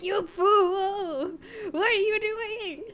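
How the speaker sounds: high, slow, whiny mock scolding of a swerving driver; mock exasperated scold. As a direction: whine at the bad driver in a put-on voice. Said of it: "be like".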